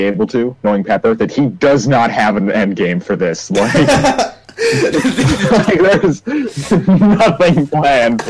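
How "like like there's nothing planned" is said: high burst of real laughter into the words; laughing. Real, not acted. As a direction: laugh as you start the sentence. Player laughs at own character.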